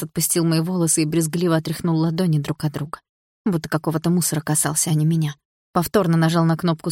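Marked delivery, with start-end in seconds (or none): none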